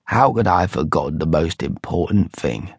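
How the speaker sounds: low Cockney grumble; grumbling to himself. A narrator's reading. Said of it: "He grumbled to himself".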